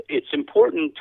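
ordinary delivery

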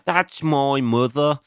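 put-on slow Birmingham accent, drawn out. Comedian quoting an angry dad from the front row, in a slow Birmingham accent. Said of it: "in a fucking Birmingham accent".

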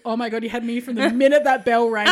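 loud, laughing relief; retold loud relief. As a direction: blurt it out loud, half laughing. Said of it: "And I was just like".

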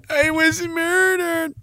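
high, soft, drawn-out mock wail; hammed-up whine. Streamer as victim insisting to his buddy, mock whine. Murder victim whining back.

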